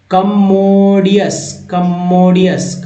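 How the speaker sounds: कमोडियस stretched slowly, syllable by syllable; slow model word. Shown as a demonstration. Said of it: "next word है".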